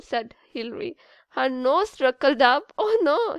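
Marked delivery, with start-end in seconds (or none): none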